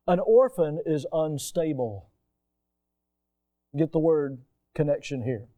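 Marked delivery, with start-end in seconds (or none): none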